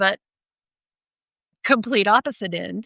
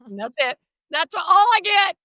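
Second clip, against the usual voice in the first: ordinary talk, nothing marked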